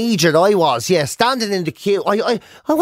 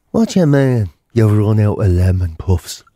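very low, drawn-out gangster threat; menacing gangster; 11 semitones lower, 3 dB louder than usual. Acted. Famous gangster who's out of lemon puffs, low menace. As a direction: drop right down and menace slowly. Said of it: "Some big famous gangster".

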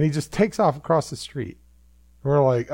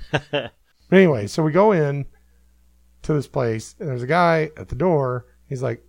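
ordinary talk

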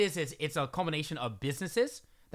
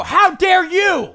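loud, higher, slow furious scolding; angry scolding; 13 semitones higher, 14 dB louder, much slower than usual. Retold or imitated. Woman at the top of the stairs scolding him, angry and loud. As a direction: jab a finger and tell him off. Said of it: "I go".

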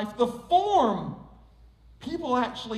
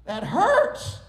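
high, pained cry of protest; hammed-up hurt yell; acted yell; 9 semitones higher, 4 dB louder, much slower than usual. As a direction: yell out in mock pain, higher and louder. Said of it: "I yelled at him and said".

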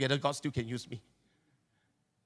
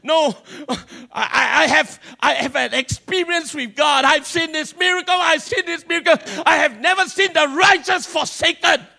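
loud, fervent declaration; preaching shout; 5 semitones higher, 6 dB louder than usual. Acted. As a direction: shout it out with conviction. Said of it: "you need to go beyond that and say".

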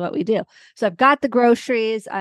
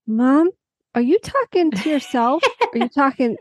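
put-on higher, puzzled little girl asking; daughter's voice; 6 semitones higher than usual. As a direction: go up high like a surprised child. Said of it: "And she goes".

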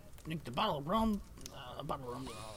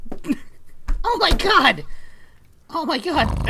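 high, loud shocked cry; alarmed shout; 5 semitones higher, 4 dB louder than usual. Acted. Gnome falls over.

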